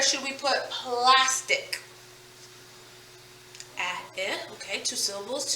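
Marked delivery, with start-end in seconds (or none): 3.8-4.4 soft, low "A", then "I", as short vowels; isolated vowel sounds. Shown as a demonstration. Teacher finding two vowels in plastic, soft isolated sounds. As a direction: quietly sound out "A" and "I" apart. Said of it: "two vowel sounds".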